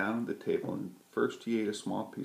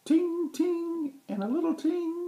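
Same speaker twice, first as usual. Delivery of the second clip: very high ringing ting; high bell-like ting; crossing bell ting; 7 dB louder than usual. A narrator's reading. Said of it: "every crossing bell said".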